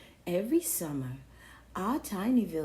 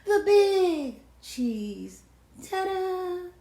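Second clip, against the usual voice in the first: grand drawn-out announcement; 9 semitones higher, 6 dB louder, much slower than usual. Acted.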